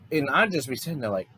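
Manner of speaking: ordinary delivery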